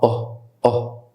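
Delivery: "اه" as a short rounded O; short O sound. Shown as a demonstration. Teacher showing the sound of O, short rounded phonics sound. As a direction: say "اه" like the start of orange. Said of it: "الأو صوتها".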